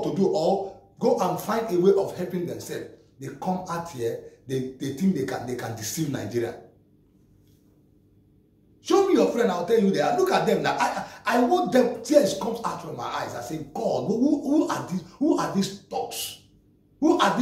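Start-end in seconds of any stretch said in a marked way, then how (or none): none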